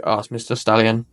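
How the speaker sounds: usual speaking voice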